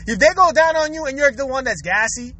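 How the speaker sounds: ordinary talk, nothing marked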